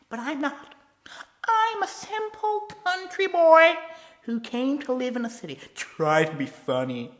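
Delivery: high, loud, slow taunting villain; Joker voice. Acted. Joker pressing Robin about the food, taunting. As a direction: lean in and purr the threat.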